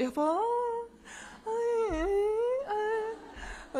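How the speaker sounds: high, whiny mock coaxing of a husband; forced sweetness, teeth clenched. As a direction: coo at your husband through gritted teeth. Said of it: "어금니 깎여 물고".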